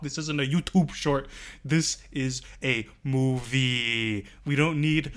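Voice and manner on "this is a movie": slow insistence. Real, not acted. Insisting, end of a list.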